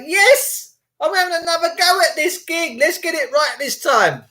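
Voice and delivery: put-on high, eager voice of a baby ready to go; a newborn thrilled to start again; fired-up newborn. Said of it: "I'm like".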